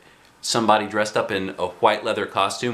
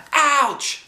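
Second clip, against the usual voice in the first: very high yelp; yelled. A narrator's reading. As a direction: cry out in pain. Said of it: "she yelled".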